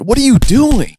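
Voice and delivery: very high cry to be released; panicked protest. Acted. Damien's arm is pinned by Best, panicked.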